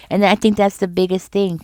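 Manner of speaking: ordinary talk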